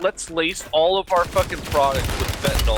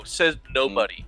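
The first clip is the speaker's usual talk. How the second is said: ordinary delivery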